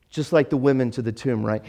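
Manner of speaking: normal talking voice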